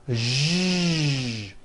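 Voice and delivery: a long buzzing "Zh" with the throat vibrating; voiced zh sound. Shown as a demonstration. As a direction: hold out the voiced zh. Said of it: "let's work on the voiced S H sound".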